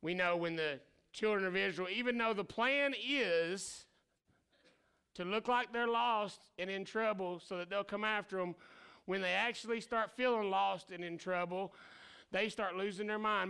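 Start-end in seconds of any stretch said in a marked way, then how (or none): none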